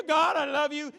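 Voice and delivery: loud, high yell to God; full-voiced worship yell; screaming praise. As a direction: shout your love out, off-tune and unashamed. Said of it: "whether you're screaming, yelling".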